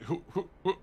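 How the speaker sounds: quick little mock jabs of sound; softly voiced stab effects; imitated stabbing noises.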